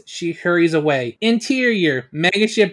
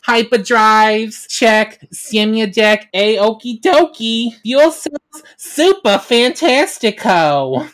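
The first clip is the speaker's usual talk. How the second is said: higher, louder, slow and jolly checklist; cheerful robot; 5 semitones higher, 5 dB louder, slower than usual. Acted. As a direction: tick off each item with glee. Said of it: "He's enjoying himself".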